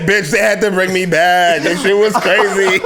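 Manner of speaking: high, slow, laughing girl impression; hammed-up gossiping girl; sassy girl voice. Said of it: "They said".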